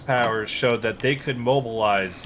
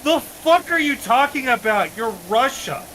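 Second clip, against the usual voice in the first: much higher, loud disbelieving mock; incredulous world; 12 semitones higher, 4 dB louder than usual. The rest of the world scoffing at Russia, loud and much higher in mock disbelief.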